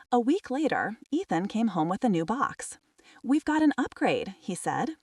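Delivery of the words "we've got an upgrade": higher-pitched, pleased announcement; grinning. A narrator's reading. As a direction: announce it with a grin in the voice.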